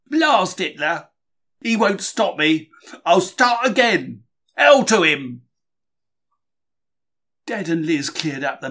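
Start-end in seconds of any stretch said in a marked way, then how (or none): 0.1-5.4 loud, defiant cursing, slow on the first words; defiant outburst. Acted. Bombed-out diarist cursing Hitler, loud defiant outburst. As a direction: shake your fist at Hitler out loud.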